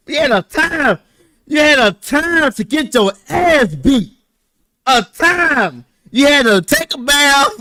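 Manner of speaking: strict mom laying down the rules; mom voiced loud. Retelling his mom's orders, mock stern and loud. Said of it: "she said".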